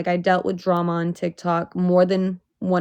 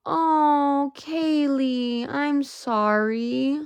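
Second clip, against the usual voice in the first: sympathetic; 7 semitones higher, much slower than usual. Real, not acted. Viewer shares a sad loss.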